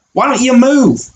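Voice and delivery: put-on high, teasing Australian accent. Pawn mocking the stuck bishop, high teasing Australian accent. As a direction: taunt the bishop in a put-on Aussie voice. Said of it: "he's got an Australian accent now".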